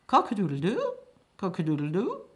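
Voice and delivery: mock rooster crowing, rising at the end. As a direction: crow it out like a rooster.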